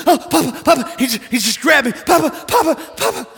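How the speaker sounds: put-on high, loud child calling for Papa; panicked toddler. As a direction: cry out clutching grandpa. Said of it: "He said".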